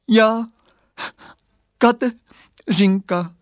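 high, slow, broken by crying; a small girl's voice torn by sobs; little girl sobbing her name; tearful little voice. Acted. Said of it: "hlásek pláčem potrhaný na kousky".

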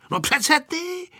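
high, drawn-out cry. A narrator's reading. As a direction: exclaim it high, as if obvious. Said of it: "vykřikla ještě hlasitěji Aneška". (hlasitější holčičí zvolání)

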